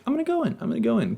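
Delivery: high-pitched, teasing mock voice; mocking imitation. Recapper mimics Kendall teasing all episode, high mocking voice. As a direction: squeak it way up high, falling at the end.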